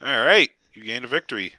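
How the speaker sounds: ordinary talk, nothing marked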